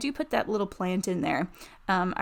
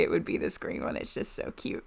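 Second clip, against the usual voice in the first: breathy whispered gush, softer; 2 semitones lower, 6 dB quieter than usual. Real, not acted. Vlogger whispering to viewers about her favorite chair, gushing.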